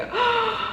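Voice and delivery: high-pitched gasp of delight. Real, not acted. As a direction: gasp at a lovely surprise. Sees more stickers.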